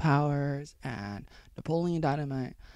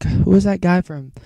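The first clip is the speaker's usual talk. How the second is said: ordinary talk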